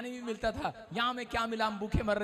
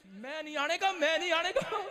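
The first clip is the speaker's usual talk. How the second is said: sulky refusal; 6 semitones higher than usual. Retold or imitated. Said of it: "इतना लाल हो गया, तत्ता हो गया".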